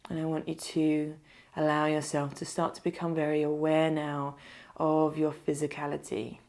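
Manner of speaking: unhurried meditation voice, leaving space to breathe; guided meditation. Acted. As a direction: lead them gently into stillness, phrase by phrase.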